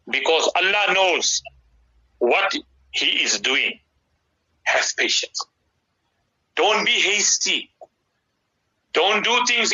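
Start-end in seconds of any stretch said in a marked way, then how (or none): 4.7-5.4 hushed whisper of advice. Real, not acted. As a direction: whisper it gently. Sermon advice, hushed for emphasis.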